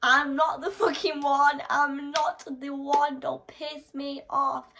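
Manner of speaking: high, loud, slow warning yell; screaming a warning in fury; furious shout. Acted. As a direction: bellow a threat. Angry outburst re-enacted.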